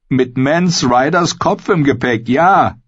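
hohe, aufgesetzte Fröhlichkeit; bemüht fröhlich. A narrator's reading. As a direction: gib dich fröhlich, mit hoher Stimme. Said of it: "gab sich Mühe, fröhlich zu klingen". (gezwungen munter, deutlich höher)

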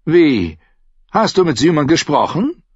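ein kurzes, erschrockenes Wie; überrascht. Acted. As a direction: stutzt misstrauisch. Tyrion überrascht. (Tyrion, überrascht und leicht lauter)

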